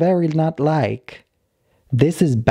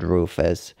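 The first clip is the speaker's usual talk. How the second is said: Rufus dropped lower and softer, stern; displeased name-calling; 6 semitones lower, 4 dB quieter than usual. Retold or imitated. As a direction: let Rufus sink low and quiet like a warning. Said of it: "But this is not good".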